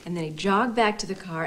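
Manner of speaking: usual speaking voice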